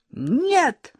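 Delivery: high, sharp cry of no; shout. A narrator's reading. Lyolka shouting at Sashka before running out, shout. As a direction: burst out with it. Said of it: "закричала Лёлька".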